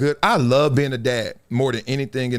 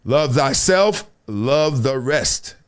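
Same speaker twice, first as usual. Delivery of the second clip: slow drawn sign-off; slow deliberate sign-off; slow motto; 3 semitones lower, much slower than usual. Acted. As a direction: drag out the motto.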